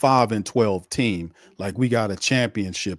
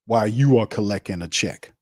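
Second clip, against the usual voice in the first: usual speaking voice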